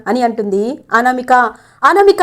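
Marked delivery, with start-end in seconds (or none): 1.8-2.2 sharp, loud angry call of her name, pitched high; loud shout. A narrator's reading. Kalyan shouting at his wife over her insult, loud. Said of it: "అని గట్టిగా అరిస్తారు కళ్యాణ్".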